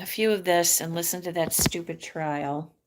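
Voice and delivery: usual speaking voice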